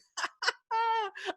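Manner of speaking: very high burst of relieved laughter; overjoyed laugh in the rain; joyful laugh. A narrator's reading. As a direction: laugh out through the tears. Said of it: "they started laughing".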